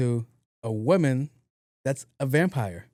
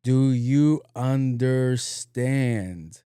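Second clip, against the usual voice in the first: stretched-out mock question; slow mocking echo; much slower than usual. Speaker mocking the security guard, slowly echoing him. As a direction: throw his slow words right back at him. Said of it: "and I went".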